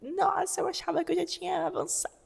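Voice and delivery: high-pitched mock whining complaint; reclamação encenada. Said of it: "eu ia reclamar". (reclamando em voz aguda, de brincadeira)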